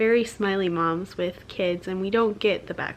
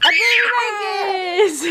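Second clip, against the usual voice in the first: high, drawn-out call broken by laughter; laughing shout; 11 semitones higher, 4 dB louder, much slower than usual. Real, not acted. Mom finds her son sitting in the box, laughing. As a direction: crack up calling out his name.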